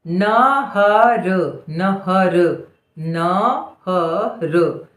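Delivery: very slow dictation; syllable by syllable. Shown as a demonstration. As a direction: draw out each sound of नहर. Dictating word sound by sound.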